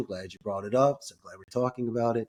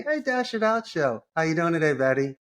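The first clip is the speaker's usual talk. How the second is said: much higher, bright greeting; upbeat cold-call greeting; 4 semitones higher than usual. Shown as a demonstration. Caller greeting the assistant Betty with high energy. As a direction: pitch it up with energy. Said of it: "And if you happen to have your assistant".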